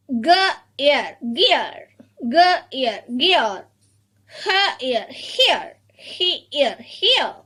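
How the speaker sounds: "G, ear" apart, then "gear" joined; sounded out then blended. Shown as a demonstration. Teacher has children read sounds, split then blended. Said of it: "read these sounds".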